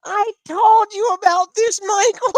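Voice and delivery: tearful mother. Retold or imitated. Mother dabbing eyes.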